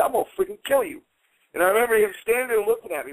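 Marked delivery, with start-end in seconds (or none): 0.0-1.0 threat. Retold or imitated. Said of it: "I said".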